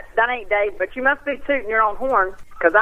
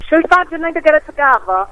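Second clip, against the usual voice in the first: a playful attempt at a British accent, higher and louder; mock British accent; 6 semitones higher, 5 dB louder than usual. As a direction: put on a hammed-up British accent. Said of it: "that had to be [name] trying to be British".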